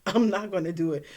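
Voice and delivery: fast, laughing no; giggling stubborn refusal. Retold or imitated. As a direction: rush it out through a laugh.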